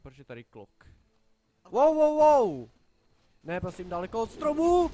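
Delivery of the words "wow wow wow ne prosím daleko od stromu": high, loud startled yelling; alarmed shout. Real, not acted. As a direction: shout in real alarm at a surprise attack. Sudden danger in game. (polekaný výkřik)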